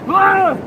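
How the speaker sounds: high yelp of panic, hammed up; mock scream. Surfer acting out a wipeout for the audience, hammed-up high yelp. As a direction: yelp like you're tumbling in a wave. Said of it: "You're like".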